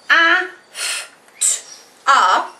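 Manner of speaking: A, f, t, a as separate sounds; sounded out. Shown as a demonstration. Teacher demonstrating her sounds of after. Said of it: "in which case I say".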